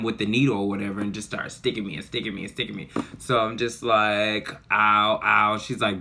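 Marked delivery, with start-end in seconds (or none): 4.7-5.6 two loud mock cries of pain; retold ow. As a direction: yelp it like the needle just went in. Needle pain at the dentist.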